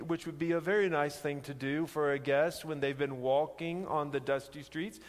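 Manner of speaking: normal talking voice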